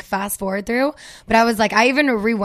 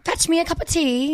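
high, grand British accent; British-accented impression, pitched high; Lisa Vanderpump; 6 semitones higher than usual. Shown as a demonstration. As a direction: play a British lady calling her darling. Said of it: "I can do a really good Lisa Vanderpump".

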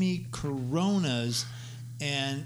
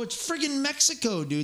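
higher, louder, fed-up protest; exasperated protest; 11 semitones higher, 3 dB louder than usual. Retold or imitated. A singer arguing with the airport official about a passport, exasperated and higher. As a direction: push back in disbelief.

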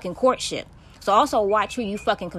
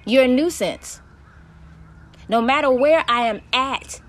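angry rant; 5 semitones higher, 5 dB louder than usual. Real, not acted. Ranting at her stalker.

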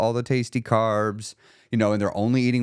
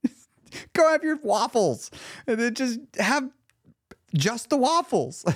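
high-pitched, pleading exasperation; imagined scolding of your past self, half laughing; exasperated urging; 12 semitones higher, slower than usual. Retold or imitated. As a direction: grab your old self by the collar. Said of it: "go back and grab myself by the collar".

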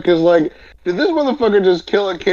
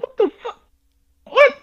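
baffled; 12 semitones higher, 3 dB louder, much faster than usual. Retold or imitated. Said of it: "I was like".

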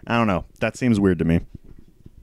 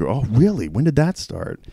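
higher, sugary surprised tone; mock sweet innocence; 6 semitones higher than usual. Rude biker charming the manager, higher, falsely sweet. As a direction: play the rude biker suddenly acting nice. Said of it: "suddenly became a nice dude".

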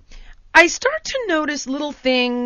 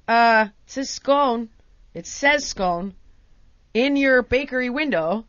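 slow, drawn-out pointed explanation; deliberate insistence; 2 semitones lower, slower than usual. Retold or imitated. Irritated customer pointing out the sign, slow and deliberate. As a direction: lay it out slowly, word by word. Spelling it out to bakery woman.

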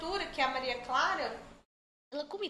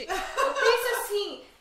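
laughing; 6 semitones higher, 6 dB louder, slower than usual. Real, not acted. Laughing about saving shampoo.

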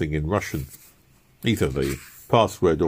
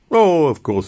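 a loud, high, eager reassurance; eager assurance; 9 semitones higher, 6 dB louder than usual. Acted. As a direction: convince him you follow politics. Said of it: "eager assurance to interrogator".

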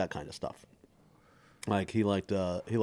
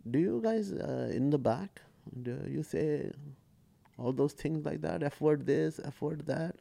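dad's voice pitched higher, a little distant; lightly voiced puzzled dad asking; a hint of the bewildered father; imitating dad; 6 semitones higher than usual. Dad quoted asking about swearing.

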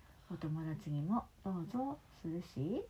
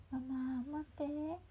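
calling after mom; 7 semitones higher, slower than usual. Acted. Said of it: "さっちゃんはママを追いかけたよ".

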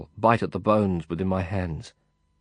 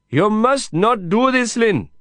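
higher, louder firm shout; shouted refusal; 14 semitones higher, 6 dB louder than usual. A narrator's reading. Kasim refuses Lin's help over the prison noise, shouting. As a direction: shout him down, no arguing. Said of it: "he shouted back at me".

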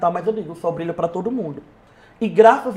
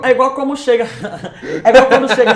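laughing while talking; 2 semitones higher, 6 dB louder, faster than usual. Real, not acted. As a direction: try to explain through real laughter. Rindo enquanto tenta explicar. (falando entre risos)

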